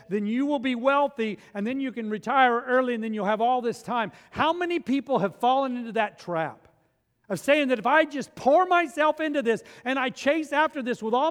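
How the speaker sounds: loud, high, driving preacher voice; fired-up sermon peak; preaching at full force. Acted. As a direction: preach it hard to the congregation.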